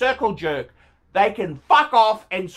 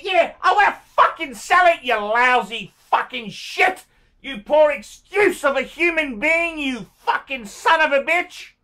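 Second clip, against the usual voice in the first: high, worked-up burst of abuse; erupt, then drag out the insults; angry cursing; 4 semitones higher than usual. Real, not acted. Seller answering the buyer's message, angry cursing.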